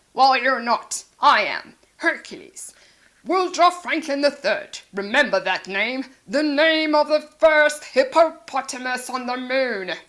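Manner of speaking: loud, grand self-important voice; boastful Hercules. Acted. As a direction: proclaim his own name.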